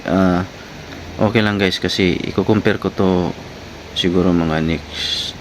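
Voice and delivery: ordinary talk, nothing marked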